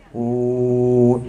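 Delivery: long low "uuuh", held on one note; mock showing-off hoot; mimicked drawn-out hoot. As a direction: hold a low, steady "uuu". Mimics sound while mocking motorbike riding.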